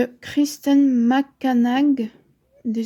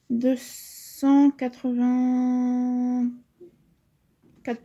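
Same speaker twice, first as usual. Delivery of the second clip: 1 drawn out; much slower than usual. Real, not acted. Drawn-out page count.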